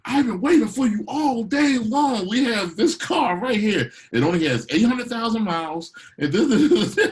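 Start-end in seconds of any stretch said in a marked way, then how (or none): 0.1-5.9 much higher, louder, slow salesman pitch; pushy car salesman. Retold or imitated. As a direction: oversell it like a car salesman on the lot. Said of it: "Go to a car salesman?".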